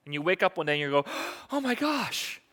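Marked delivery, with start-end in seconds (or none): 1.3-2.4 gasp, then a high, hushed cry of dismay; acted shock. Waking up one day to find the damage, acted shock. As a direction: gasp in horrified realization, high and soft.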